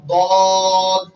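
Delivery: দদ said slowly and loud; dictated letter. Shown as a demonstration. Teacher dictating to pupils: slow loud দদ. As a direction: stretch out দদ slowly and loudly. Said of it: "উচ্চারণ করলাম".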